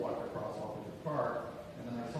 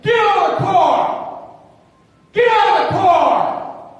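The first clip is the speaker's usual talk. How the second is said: very high, loud, drawn-out roar; enraged yelling; 20 dB louder, slower than usual. Acted. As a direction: scream the order at full force. Acted cyclist pounding, yelling at driver.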